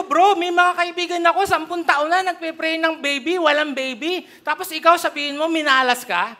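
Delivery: exasperated pastor scolding a careless father; irritated scolding. Retold or imitated. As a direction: scold him like an annoyed pastor. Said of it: "nainis talaga ako".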